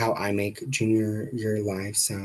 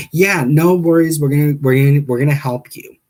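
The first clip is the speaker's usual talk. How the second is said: loud, higher receptionist impression; mock reassurance, pitched up; reassuring receptionist; 5 semitones higher, 9 dB louder than usual. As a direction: imitate an over-eager call handler. Call handler voiced in retold call.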